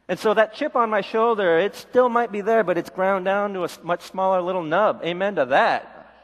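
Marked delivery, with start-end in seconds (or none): none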